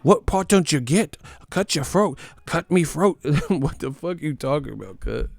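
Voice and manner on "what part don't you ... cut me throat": high, louder mock British threat; hammed-up British fan snarling a threat; mock angry British fan. Mocking fans threatening critics, high hammed-up British accent. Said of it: "they're like".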